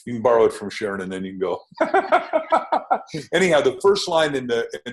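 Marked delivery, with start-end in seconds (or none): 1.6-3.3 hearty laugh. Real, not acted. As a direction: burst of high laughter. Host laughs at own joke.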